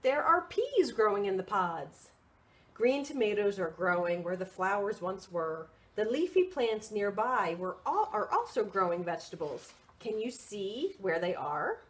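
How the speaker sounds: lively picture-book read; reading to children. Acted. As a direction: read it out brightly for little ones.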